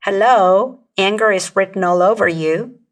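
loud, sarcastic "Hello" full of judgment; mock them for being obvious; judgmental. Shown as a demonstration. Coach shows a judging reply, loud and sarcastic. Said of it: "conveys judgment".

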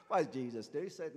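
normal talking voice

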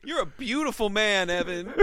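put-on high, loud drunken affection; drunk Seth gushing. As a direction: slur out sloppy praise to a friend. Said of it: "And then he'd be like".

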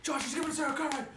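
high, fast, giddy chatter; excited dog voice. Pretend. Dog telling dog about the car ride, giddy excitement. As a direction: gabble like a thrilled dog.

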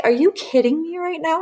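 high, disbelieving yelp; mock-shocked are you kidding me; incredulous. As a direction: act shocked, voice shooting up. Retold disbelief at the choking.

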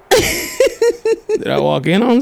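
high, slow, knowing tease; teasing question. Retold or imitated. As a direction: draw it out slyly. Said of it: "And I was like".

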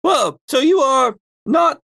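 high, swooping character voice; Phoebe. Acted. Phoebe dismisses the question in her high affected voice. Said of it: "my Phoebe voice is this".

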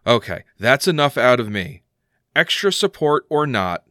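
ordinary talk

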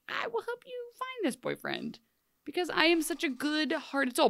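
soft, very high put-on sweet voice; mock saintly. Hook offering to find her boyfriend, mock saintly high voice. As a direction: imitate an exaggeratedly kind-hearted helper.

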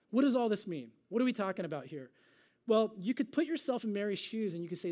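ordinary delivery

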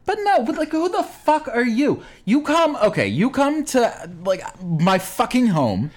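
indignant, high-pitched acted rant; angry confrontation. New Yorker confronting a marathon runner, indignant.

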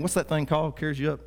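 usual speaking voice

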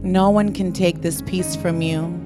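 usual speaking voice

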